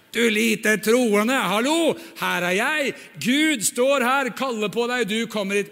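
slow, loud telling-off; scolding sermon. Acted. Jesus lecturing sinking Peter, slow and loud. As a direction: lecture him hard.

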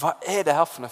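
ordinary talk

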